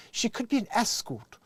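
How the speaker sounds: high-pitched mock English accent. Dear Abby guesses at stepdaughter's job, mock English accent. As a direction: put on an English columnist's voice. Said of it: "is Dear Abby an English person?".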